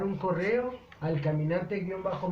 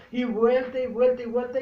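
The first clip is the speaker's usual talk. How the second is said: emphatic repetition; 5 semitones higher, 6 dB louder than usual. Real, not acted. Preacher repeating for emphasis.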